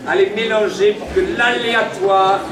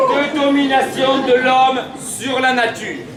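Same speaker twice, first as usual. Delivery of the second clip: town crier; 4 semitones higher, slower than usual. Acted. Proclaiming to crowd.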